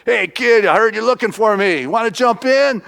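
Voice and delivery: high, loud showman's hail; calling out. Retold or imitated. Wrestler hailing boy from loud convertible, calling out. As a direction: call out to a kid from a car.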